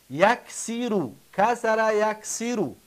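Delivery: یکسیرو said slowly and carefully; slow demonstration. Shown as a demonstration. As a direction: sound it out slowly for the class. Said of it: "ببینید". (آهسته و با دقت)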